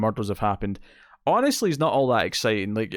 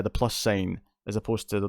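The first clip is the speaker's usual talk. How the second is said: normal talking voice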